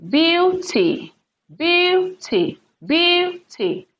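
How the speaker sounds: BEAUty, BEAU high and stretched, ty falling; stress on first syllable. Shown as a demonstration. Teacher drilling beauty as a noun, BEAU stressed high. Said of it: "first syllable, because this is a noun".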